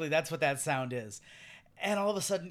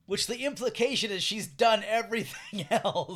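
louder, higher, breaking into a laugh; 5 semitones higher, 6 dB louder than usual. Real, not acted. Host amused by what Wanda's denial implies, laughing. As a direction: let real laughter overtake the last words.

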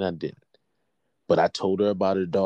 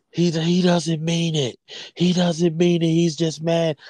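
put-on high, louder child's protest; pleading older child; 10 semitones higher, 5 dB louder, slower than usual. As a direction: plead for your brother, pitched way up. Retold older child defending brother.

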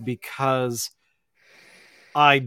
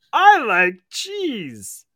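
silly high voice; 10 semitones higher, 7 dB louder, much slower than usual. Pretend. Mind freed to say something silly.

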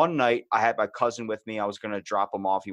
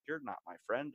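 quiet; 2 semitones higher, 11 dB quieter than usual. Retold or imitated. Quietly backing out of car.

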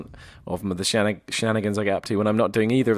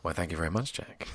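close mic; 4 semitones lower, 10 dB quieter than usual. Acted. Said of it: "close mic approach for this one".

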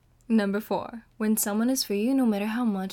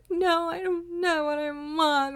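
slow, higher, tearful wail; crying; 8 semitones higher, 4 dB louder, slower than usual. Retold or imitated. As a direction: plead through tears, slowly. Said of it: "I'm like".

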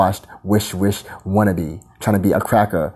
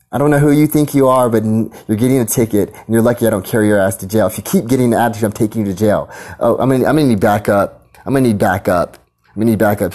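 mock cop; 3 semitones higher than usual. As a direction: bark orders like a power-tripping officer. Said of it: "when they walk through and say".

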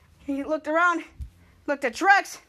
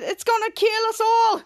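high, slow doom-laden wail; mock-panic about certain death; panicked; 6 semitones higher than usual. [name] warning everyone, panicked. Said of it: "[name] going into panic".

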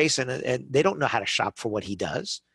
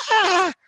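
frustrated groan; 7 dB louder than usual. Retold or imitated. Frustrated at too many choices.

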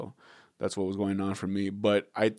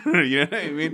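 higher, louder, through a laugh; 7 semitones higher, 6 dB louder than usual. Real, not acted. Joking to co-host about telling accents apart, laughing. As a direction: keep laughing as you ask it.

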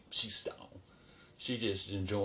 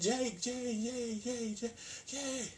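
much higher calls of a name, fading softer; mock screaming for help; 4 dB quieter, much slower than usual. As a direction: imitate her frantic cries for Jake. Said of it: "She's screaming for her boyfriend".

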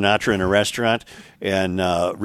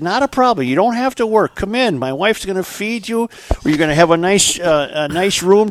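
lifted high, eager welcoming farmer; 8 semitones higher than usual. Acted. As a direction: play the hospitable farmer up high. Farmer voiced in joke.

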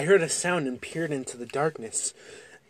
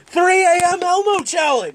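much higher, loud clickbait yell; youtuber shout; 11 dB louder than usual. Acted. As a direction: hype it like a thumbnail title. Yelling a clickbait title.

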